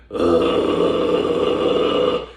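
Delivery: deep raspy held grunt; held false chord growl. Shown as a demonstration. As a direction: brace and hold it. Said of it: "get good at holding it using your diaphragm".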